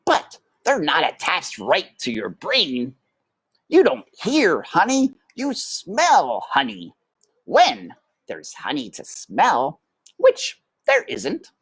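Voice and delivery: high, cheeky brother mouse; matter-of-fact big brother; Toulouse. A narrator's reading. Toulouse mocks.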